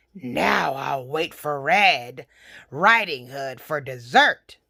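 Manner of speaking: low, slow wolf voice; gloating wolf. Acted. Wolf gloats after eating.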